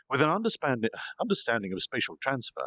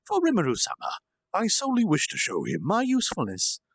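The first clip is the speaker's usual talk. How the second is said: lightly voiced gracious demon, higher; lovely friendly demon; 6 semitones higher than usual. Demon reassuring the wary devils, lightly voiced and friendly. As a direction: soothe them with polite charm. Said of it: "a lovely and friendly voice".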